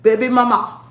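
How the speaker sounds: briefly imitated Nigerian accent; Pookie. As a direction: echo it in a mock Nigerian accent. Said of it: "That's what the Nigerian podcast Pookie said".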